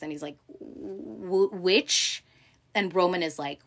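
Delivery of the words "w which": mock stammer, stuck on the w. Kendall caught out by his sister, imitated stammering. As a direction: stumble into the word, imitating a stutter. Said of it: "like, he kinda stammers".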